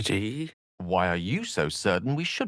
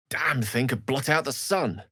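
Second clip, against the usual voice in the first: normal talking voice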